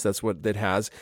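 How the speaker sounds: ordinary talk, nothing marked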